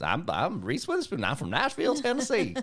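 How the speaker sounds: light Nashville twang, rising high; imitating a young Nashville accent; Tennessee accent. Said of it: "Like that".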